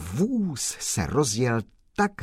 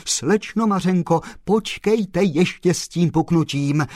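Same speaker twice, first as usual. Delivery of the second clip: higher and louder, hurrying to stop her; urgent plea; 8 semitones higher, 6 dB louder than usual. Acted. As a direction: jump in before she breaks. Postman urgently stops her.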